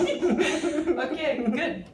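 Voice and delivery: laughing through the word; giggling okay. Real, not acted. Laughing after funny face exercise.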